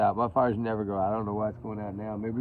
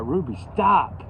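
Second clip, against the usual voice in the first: firm command; 5 semitones higher, 7 dB louder, slower than usual. Real, not acted. Scolding dog.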